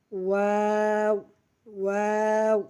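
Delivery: very long "ওয়াও" rounded from both lips; two-lip sound. Shown as a demonstration. Teacher showing learners the letter, "ওয়াও" drawn out evenly. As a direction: stretch "ওয়াও" slowly on one level note. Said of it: "দুই ঠোঁট হইতে".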